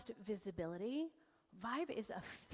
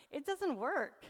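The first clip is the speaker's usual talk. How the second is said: higher, louder mock whine; mock complaint; 5 semitones higher, 5 dB louder, slower than usual. Speaker mimics people who quit posting videos, mock complaint. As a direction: imitate someone giving up, pitched up and loud. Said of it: "kind of fallen off the horse and like".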